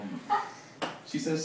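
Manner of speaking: ordinary talk, nothing marked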